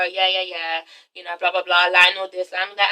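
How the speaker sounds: low, soft, offhand rattling-off; nonchalant. Retold or imitated. Yelena casually updating Terrence, nonchalant. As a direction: toss it away as unimportant chatter, low and quiet. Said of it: "she does it kind of nonchalantly".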